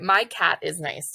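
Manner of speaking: slow, word by word; slow dictation. Shown as a demonstration. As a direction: read it like a child sounding out. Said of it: "this kiddo is gonna write".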